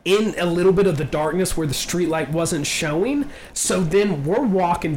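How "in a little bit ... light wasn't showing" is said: ordinary delivery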